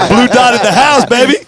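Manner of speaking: laughing, high and loud hype; excited cheer. Real, not acted. Hyping up a racer's win.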